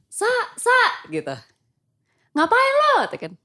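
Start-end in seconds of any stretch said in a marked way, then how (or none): 0.1-0.8 high shouted call from far below; calling from downstairs. Retold or imitated. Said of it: "kayak teriak dari bawah". 2.3-2.9 shouted question. Retold or imitated. Indra yelling from another floor.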